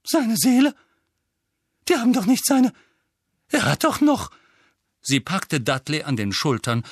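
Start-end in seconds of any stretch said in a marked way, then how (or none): 0.0-4.3 high, loud, faltering alarm; Tante Petunia, panicked. Acted. Aunt fears for her son's soul, panicked. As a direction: break off in high-pitched horror.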